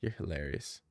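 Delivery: usual speaking voice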